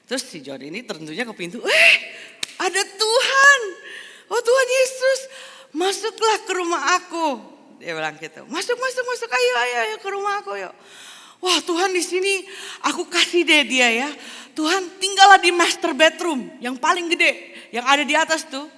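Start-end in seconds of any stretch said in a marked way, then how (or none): 1.6-7.3 very high, loud, thrilled squeal of welcome; John's delighted welcome. Acted. John greets God at door. 8.5-10.7 high, loud, excited urging; eager invitation. Acted. John eagerly invites God in. 11.4-14.1 high, loud, beaming offer; generous host. Acted. John offers room to God. 14.5-18.7 very high and loud, showing off the biggest room; John's proud offer. Acted. John offers master bedroom.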